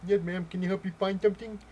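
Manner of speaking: loud, high put-on voice, hammed-up; exaggerated imitation of a deaf speaker; mock deaf voice. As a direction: pretend you can't hear yourself talking. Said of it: "I'm, I'm deaf".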